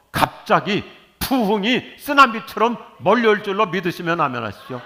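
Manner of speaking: preaching at full force, pitch climbing; rousing preaching. Acted. Pastor rallying the congregation to say amen, full-force preaching. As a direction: build them up to shout amen.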